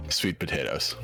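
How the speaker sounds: normal talking voice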